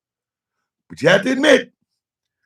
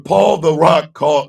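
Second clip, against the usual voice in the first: usual speaking voice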